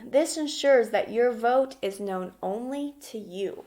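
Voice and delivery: ordinary talk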